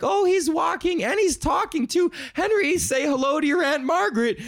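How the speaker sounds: put-on very high gushing baby-talk pitch; hammed-up high adoring squeal; doting parent. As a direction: coax the toddler in a high singsong. Acts doting parent prompting toddler.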